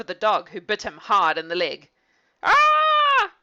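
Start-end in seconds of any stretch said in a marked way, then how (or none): 2.4-3.3 very high, loud cry as the dog bites; shout of pain. Acted. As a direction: shriek at the sudden bite. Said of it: "shouted Lefty".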